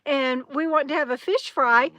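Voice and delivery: ordinary delivery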